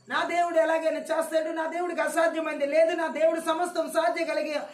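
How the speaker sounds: loud, high proclamation; preaching at full force. Acted. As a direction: proclaim God's power to the congregation. Preacher proclaims God's power.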